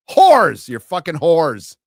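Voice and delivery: a sudden loud yell; furious shout. Real, not acted. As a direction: bellow the insult at them. Ranting yell at media.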